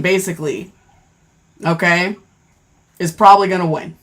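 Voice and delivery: normal talking voice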